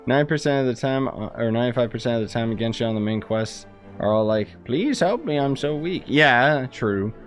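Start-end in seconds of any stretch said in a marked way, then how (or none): none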